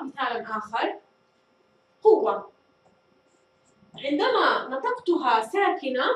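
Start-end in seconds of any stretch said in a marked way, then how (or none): 2.0-2.5 قوة said with a clear final تُ; ta with damma. Shown as a demonstration. Teacher to students, ta marbuta with damma: clear demo. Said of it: "نضع قوة".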